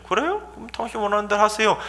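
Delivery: high, soft, hurried 'oh, sure'; easy assent. Acted. Person facing an angry complainant, high soft easy agreement. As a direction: give in at once, lightly. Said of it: "갑자기 그 사람이 내 말을 듣더니".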